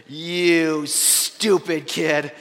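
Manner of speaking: slow, seething inner voice, starting very soft; drawn-out, held-in exasperation; suppressed anger. Retold or imitated. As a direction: act out biting back fury, word by word. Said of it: "bit my bottom lip so hard".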